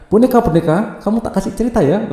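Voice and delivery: high, loud, playful princess talking to her doll; little princess voice. Acted. Princess Gemma telling her doll a story, high and loud. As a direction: call out sweetly to your doll like a small girl. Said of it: "Dia bercerita pada bonekanya".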